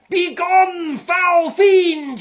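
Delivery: high, loud furious bellow; yelled. A narrator's reading. Gerda yelling at the security guard, a furious high bellow. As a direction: roar it like a prophet banishing a demon. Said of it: "he yelled".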